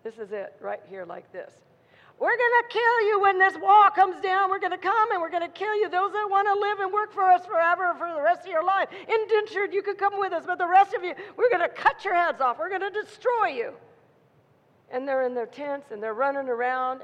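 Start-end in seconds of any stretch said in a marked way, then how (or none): 2.2-13.7 loud, high-pitched war shouting; menacing threats. Acted. Pharaoh's men scaring the people in their tents, shouted threats. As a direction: bellow threats across at the enemy. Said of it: "those voices are just scaring them".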